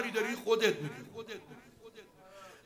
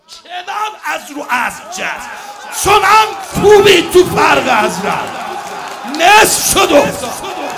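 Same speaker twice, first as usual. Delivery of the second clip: higher, much louder climactic shout; full-force preaching shout; 8 semitones higher, 12 dB louder than usual. Acted. Preacher roaring the killing blow to the mourners, full shout. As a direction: roar the killing blow to the crowd.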